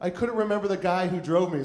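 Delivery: ordinary talk, nothing marked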